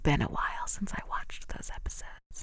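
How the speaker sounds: hushed whisper. Real, not acted. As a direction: drop to a whisper. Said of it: "Why are we whispering?".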